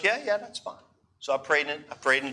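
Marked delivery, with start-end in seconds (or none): 0.0-0.8 put-on higher, softer voice of a young woman; imitated young woman. Israeli girl agreeing to prayer, lightly imitated soft voice. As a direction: lightly mimic her quick agreement. Voicing the Israeli girl's reply.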